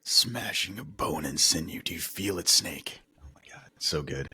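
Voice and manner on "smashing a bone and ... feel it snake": Gray Fox. Acted. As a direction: savour each word like a pained cyborg ninja. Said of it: "that scene with him and Gray Fox".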